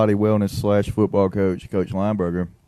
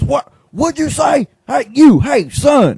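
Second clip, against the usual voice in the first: much higher, louder mock coach shout; coach yelling; 7 dB louder, much slower than usual. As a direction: bark at the kid like an outraged coach.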